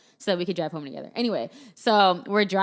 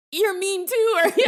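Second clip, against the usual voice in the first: husband's voice; 11 semitones higher, 5 dB louder than usual. Retold or imitated. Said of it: "He's like".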